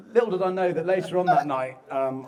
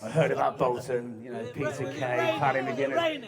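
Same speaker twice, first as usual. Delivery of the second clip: ordinary talk, nothing marked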